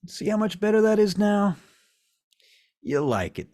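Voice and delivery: high, loud, triumphant taunt; gloating coaxing. Acted. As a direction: talk them into loving it. Garwood gloats over compelled victim.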